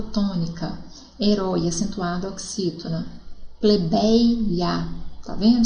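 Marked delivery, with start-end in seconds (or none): 3.6-4.9 "Plebeia" drawn out, split before the final a; "Plebeia", syllable by syllable. Shown as a demonstration. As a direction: separate "Plebeia" as ple-bei-a. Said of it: "Se separa".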